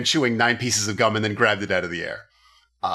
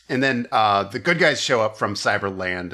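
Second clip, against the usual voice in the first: ordinary talk, nothing marked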